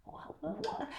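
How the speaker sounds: breathless whispered what; whispered disbelief. Retold or imitated. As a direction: mouth it in shock. Stunned at aged friends.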